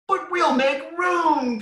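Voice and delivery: very high, loud, slow squeaky chick; peeping chick. A narrator's reading. Smallest chicken reassuring the big chick, eager peep. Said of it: "peeped the smallest chicken".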